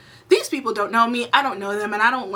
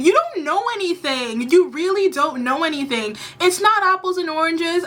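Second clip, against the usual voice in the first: heated rebuke; 6 semitones higher than usual. Real, not acted. Rebuking David after clip.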